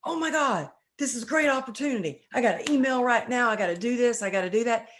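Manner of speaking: fast, high, breathless rush of eager panic; a hint of frantic overachiever. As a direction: rattle it off like everything is urgent. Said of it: "one of those people that's like".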